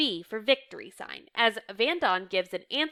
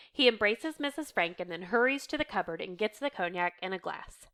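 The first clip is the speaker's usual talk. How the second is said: ordinary delivery, nothing marked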